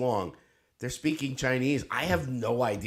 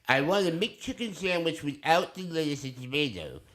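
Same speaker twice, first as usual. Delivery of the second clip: higher, goofy ordering voice; high-pitched joke voice; silly put-on voice; 5 semitones higher than usual. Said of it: "could not stop doing the voice".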